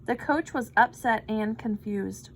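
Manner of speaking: plain reading voice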